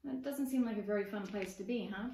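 ordinary delivery

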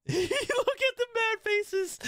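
high, slow laughing taunt; cracking up at the angry faces. Real, not acted. Gamer laughs at the opponent spamming mad faces, laughing.

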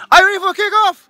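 high, rousing shout to the teams; rousing call. Real, not acted. Host asks both teams if they are ready for kickoff, rousing shout. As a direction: call it out high to fire them up.

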